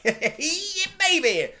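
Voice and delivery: put-on high, laughing, eager "yeah baby"; giddy mock enthusiasm; eager yell. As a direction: cheer it like a thrilled new boyfriend. Boyfriend's eager reply, quoted.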